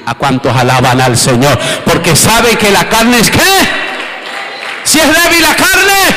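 high shouted prayer, slowing on key words; preaching at full force. Acted. Pastor leading the church in a shouted prayer. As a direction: lift the crowd into praise.

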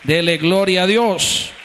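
loud, ringing call to give glory; preaching at full force. Acted. Pastor calling the congregation to glorify God, loud preaching. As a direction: rouse the church to praise God, full voice.

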